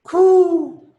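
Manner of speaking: high dove call; dove coo. Acted. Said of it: "One was a dove".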